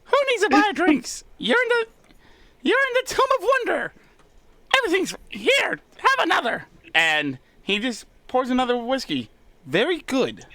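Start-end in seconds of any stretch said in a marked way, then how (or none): 0.0-6.6 high squeaky bartender kobold. Acted. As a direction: pour drinks in a high squeak. Charlie pouring drinks.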